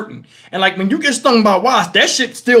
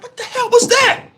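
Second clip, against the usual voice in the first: high, startled, incredulous cry; baffled yelp pitched way up; acted bewilderment; 13 semitones higher than usual. Acts out baffled reaction.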